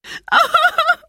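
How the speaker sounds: high crying sound; girl sobbing harder. A narrator's reading. Girl sobbing in the engine cab. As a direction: cry harder. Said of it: "Roberta cried harder".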